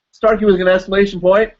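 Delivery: high-pitched exclaimed name; loud exclamation. Shown as a demonstration. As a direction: call it out as if ending in an exclamation point. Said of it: "I'll say that loud".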